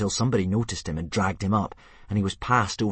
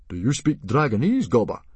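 loud, higher, startled question; surprised; 5 semitones higher, 7 dB louder than usual. Acted. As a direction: catch him off guard with surprise. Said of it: "asked Stoic in surprise".

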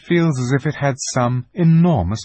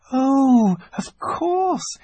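put-on very high, delighted little girl; 10 semitones higher than usual. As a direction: gasp of recognition, girlish. Lucy voiced as a little girl.

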